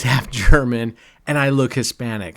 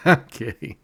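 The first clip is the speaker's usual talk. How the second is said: ordinary talk, nothing marked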